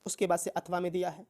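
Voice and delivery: normal talking voice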